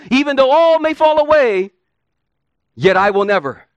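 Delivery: high, loud Peter swearing he won't fall; preacher voicing cocksure Peter's loud vow; proud vow.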